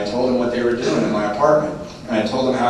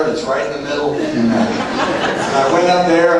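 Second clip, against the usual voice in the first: ordinary talk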